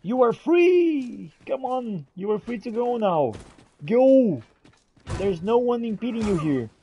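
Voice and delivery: ordinary talk, nothing marked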